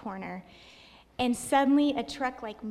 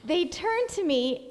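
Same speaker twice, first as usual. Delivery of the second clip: usual speaking voice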